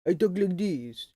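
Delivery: put-on French accent from the front of the mouth; exaggerated French accent; mock French accent. Shown as a demonstration. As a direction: caricature a French speaker's accent. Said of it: "get close to sounding like I speak French".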